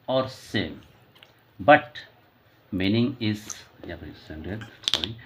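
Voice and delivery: very slow, word by word, like dictating notes; slow dictation. Shown as a demonstration. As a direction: spell out the definition slowly for students. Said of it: "teacher dictating a definition". (धीमे-धीमे, शब्द-दर-शब्द बोलकर लिखवाना)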